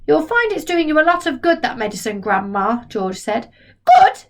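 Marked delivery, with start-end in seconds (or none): 0.1-2.8 put-on cheerful boy. George voiced. 3.9-4.3 very high, much louder scream. Acted. Grandma shrieks back at George. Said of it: "she screamed".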